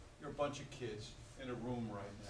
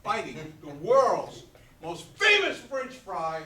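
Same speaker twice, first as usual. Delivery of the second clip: louder, slower, swelling with excitement; excited build-up; 7 semitones higher, 15 dB louder, slower than usual. Acted. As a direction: sell the joy of that first fry. Describing a customer's joy.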